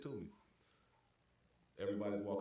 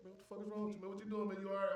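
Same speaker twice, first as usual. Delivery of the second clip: alarmed call; 10 semitones higher, faster than usual. Retold or imitated. Worried call to a friend.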